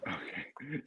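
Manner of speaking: whispered okay under the breath; a soft aside to himself. Real, not acted. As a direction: breathe it out, barely voiced. Whispered aside before interview.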